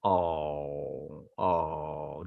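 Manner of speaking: low, drawn-out sympathetic oh. Real, not acted. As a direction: sigh it out in sympathy. Sad story ending.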